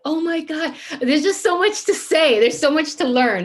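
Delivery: high, loud overwhelmed delight; imitating her future self rewatching, gushing; excited gushing. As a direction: gush like you can't contain it. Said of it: "I'm gonna watch this again and be like".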